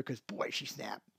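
hushed, slow whisper; whispered aside. Real, not acted. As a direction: lean in and whisper it. Aside about the snap.

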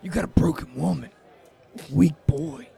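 put-on TV tough-guy voice; Shane. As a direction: imitate a taunting rival with long pauses. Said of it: "Shane's like".

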